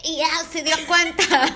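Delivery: slow, drawn-out mock dubbing voice; put-on dubbing parody; imitating friend's dub. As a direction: mimic your friend's bad dub voice, slowly. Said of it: "le hice igual que tú".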